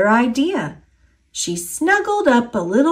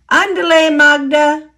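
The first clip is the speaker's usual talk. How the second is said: higher, louder call to hurry her along; eager urging; 7 semitones higher, 6 dB louder than usual. A narrator's reading. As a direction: chivvy the child on, bright and loud. Grandmother urging Magda on.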